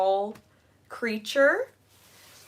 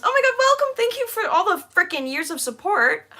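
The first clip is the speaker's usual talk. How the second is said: high, loud gushing thanks; excited thanks; 11 semitones higher, 6 dB louder than usual. Real, not acted. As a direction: gush with delighted gratitude. Thanking a long-time supporter.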